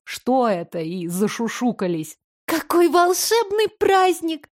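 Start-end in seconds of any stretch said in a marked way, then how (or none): 2.4-4.5 put-on high, slow thrilled girl; excited whisper. A narrator's reading. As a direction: gasp it in delight. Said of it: "шёпот Жюли".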